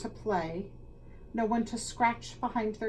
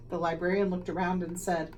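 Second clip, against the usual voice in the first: usual speaking voice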